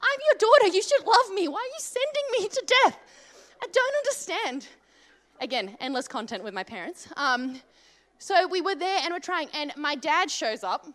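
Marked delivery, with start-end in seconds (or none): 0.1-4.7 high, laughing mock plea; whiny protest. Daughter pleading with mum not to be sent to the ram, whiny. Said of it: "I'm like".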